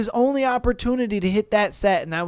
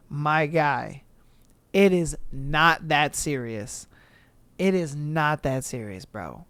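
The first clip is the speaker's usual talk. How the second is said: calm; 6 semitones lower, 6 dB quieter than usual. Real, not acted. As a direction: talk him down gently. Calming the angry lifter.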